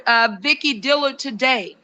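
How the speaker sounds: ordinary talk, nothing marked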